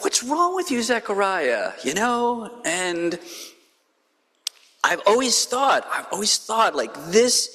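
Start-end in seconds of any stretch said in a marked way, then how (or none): 0.0-1.7 high, exasperated cry at Zechariah; exasperated question. Retold or imitated. Preacher voicing her own reaction to Zechariah, high and exasperated. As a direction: throw up your hands in mock disbelief. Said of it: "I'm like".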